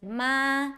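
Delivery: MA held level and flat; first tone. Shown as a demonstration. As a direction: sustain "ma" on one even pitch. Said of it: "First tone is flat".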